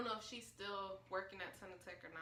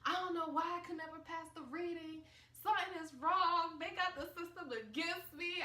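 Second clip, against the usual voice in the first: higher, louder fed-up rant; frustrated rant; 7 semitones higher, 5 dB louder than usual. Retold or imitated. Student venting about failing the reading test, higher frustrated rant. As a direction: vent at the unfair test. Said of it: "I was like".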